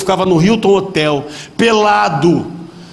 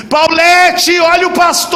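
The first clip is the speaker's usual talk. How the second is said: high, loud camp yell across the street; shouted warning; 10 semitones higher, 3 dB louder, slower than usual. Retold or imitated. Travesti on the corner warning Paulette, high loud yell. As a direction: call out high and loud in alarm. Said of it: "o travesti gritou assim".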